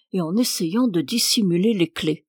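ordinary delivery, nothing marked

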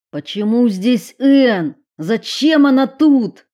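loud high desperate shouting; frantic yell. Acted. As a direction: scream the questions. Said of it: "истошно закричал Уилл".

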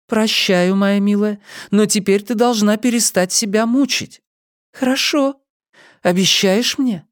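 high, tender motherly voice; high-pitched gentle reassurance; forgiving mother. A narrator's reading. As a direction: soothe her in a lifted voice. Mother forgives and soothes daughter.

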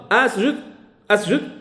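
"أسجد" as asjud, opening on a; initial fatha. Shown as a demonstration. Teacher testing fatha with students, "أسجد" begun with a. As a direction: begin "أسجد" with an open a before the s. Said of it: "تنطق بهمزة الوصل بالفتح".

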